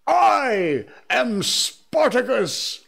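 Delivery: high, slow, drawn-out proclamation; mock yell. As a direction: declare it like a film hero. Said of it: "I stood up and yelled".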